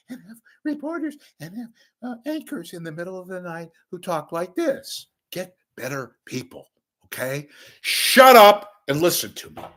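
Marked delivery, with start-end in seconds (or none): 0.1-5.1 lightly higher put-on anchor voice, fading back down; a hint of a stiff news-anchor voice; mock anchor voice. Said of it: "anchors ... who talk like this". 7.8-9.6 loud demand. Real, not acted. Ranting at critics.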